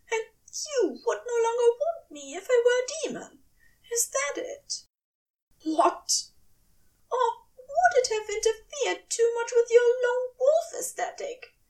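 high, tear-choked fury; tearful angry angel. A narrator's reading. Aziraphale rages at Crowley, high and tearful. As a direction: lash out through tears. Angel accuses, near tears.

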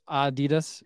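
"Adidas" opening on a stressed AH; first-syllable stress Adidas. Shown as a demonstration. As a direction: say "Adidas" as AH-dee-dus. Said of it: "there are different pronunci".

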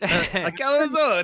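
put-on very slow, stretched Italian-accented word; mock Italian. Teasing a friend about his cooking, drawn-out mock Italian accent. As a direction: drag the word out like a cartoon Italian. Mimicking an Italian saying calzone.

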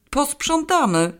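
Posprzątamy high and slow, in disbelief; high, slow, disbelieving Posprzątamy; incredulous echo. A narrator's reading. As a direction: echo Posprzątamy back, stunned. Incredulous echo of her word.

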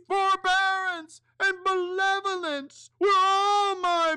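very high, wailing ghost; a grieving ghost protesting. Acted. Ghost's anguished speech.

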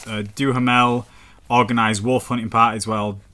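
usual speaking voice

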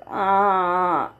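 low, drawn-out acted groan; deep groan. A narrator's reading. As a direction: let out a low groan, as narrated. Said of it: "another groan reached their ears".

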